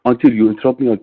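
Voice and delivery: usual speaking voice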